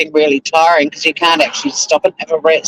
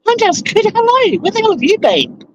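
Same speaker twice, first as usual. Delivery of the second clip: excited greeting; 11 semitones higher than usual. Real, not acted. Greeting a long-missed viewer in chat, excited and much higher. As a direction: squeal a delighted welcome back.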